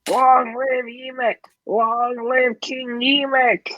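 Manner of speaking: high, slow goblin chant; goblin crowd cheer. Acted. Goblins hailing King Yemek, high slow chant. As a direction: hail the new king, drawn out.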